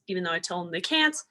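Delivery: usual speaking voice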